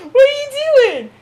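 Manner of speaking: high-pitched pet scolding; squealed at cat. Real, not acted. Talking to her cat again.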